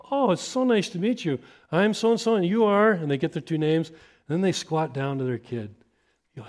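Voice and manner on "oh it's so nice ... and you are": bright, high, eager welcome; cheerful greeter. Retold or imitated. Greeter welcoming a new family at the door, high and bright. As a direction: greet them like a long-lost friend. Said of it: "A greeter meets them and goes".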